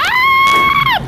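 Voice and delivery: high loud scream as the plane hits; crash scream. Real, not acted. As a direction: shriek at the impact. Crash in game.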